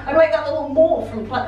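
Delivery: ordinary talk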